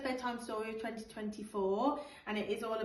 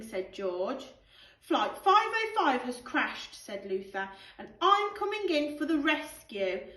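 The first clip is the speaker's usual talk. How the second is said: put-on lively storybook read-aloud for children; animated picture-book narration with character voices; bedtime story reading; 5 dB louder, slower than usual. Teacher reads bedtime story aloud.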